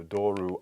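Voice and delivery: "doru" said slowly with the stress on the first syllable; slow demo of "doru"; Greek genitive doru. Shown as a demonstration. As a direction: model "doru" carefully for the class. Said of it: "change it from the circumflex to the acute".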